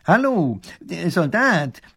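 high, hesitant hail; Professor Pfiffikus. A narrator's reading. Professor hailing a soldier, high halting call. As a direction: call out haltingly, like a fussy official.

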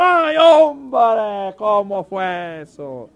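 high, loud "¡Ay, hombre!" then a slow drawn-out question; excited fan. Retold or imitated. Preacher imitating himself now hooked on baseball, excited. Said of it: "le estoy diciendo al otro".

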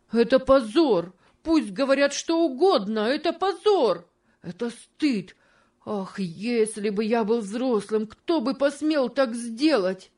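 high, tearful outcry; с плачем и угрозами. A narrator's reading. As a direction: cry out through tears, threatening. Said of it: "вскрикнул с плачем и угрозами". (вскрикнул с плачем)

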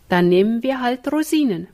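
ordinary delivery

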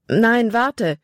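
a half-held call after him; Libby starts to call Jordan back. Acted. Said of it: "wollte Libby rufen".

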